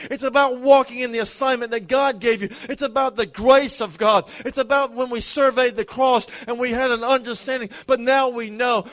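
loud, rising preacher's cadence, driving each line home; preaching at full force. Acted. Pastor urging the standing church to receive, preached full force. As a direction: pour it out over the congregation like a revival call.

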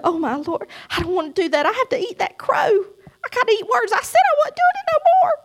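building wail. Retold or imitated. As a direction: let the lament climb to a tearful cry. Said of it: "And I started crying".

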